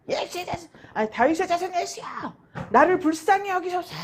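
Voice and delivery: high, strained pleading cry; crying out harder. Retold or imitated. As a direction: call out desperately for mercy. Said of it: "더욱더 심히 소리를 질렀다".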